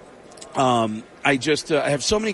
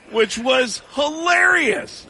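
laughed out slowly, pitched way up; laughing; 11 semitones higher, much slower than usual. Real, not acted. Comedian recalling the player's joke, laughing high and slow. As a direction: squeal it through laughter.

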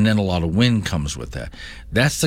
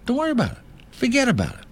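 high mock reassurance; much faster than usual.

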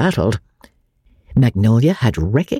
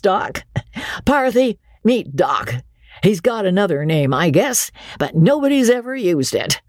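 high, hearty showman's patter; bluff riverboat captain; 8 semitones higher than usual. A narrator's reading. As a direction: introduce them with nervous bravado. Andy voiced again.

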